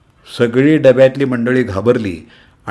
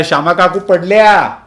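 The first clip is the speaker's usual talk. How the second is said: higher, louder repeat of the cry; louder alarmed shout; 8 semitones higher, 5 dB louder than usual. Acted. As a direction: yell it again, more urgent. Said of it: "असा ओरडा झाला".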